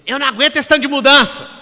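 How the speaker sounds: loud, high complaining mock coworker; whining coworker. Coworker complaining loudly about constant change. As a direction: wail it like a fed-up colleague. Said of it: "eu via pessoas falando assim".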